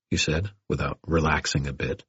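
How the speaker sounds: ordinary delivery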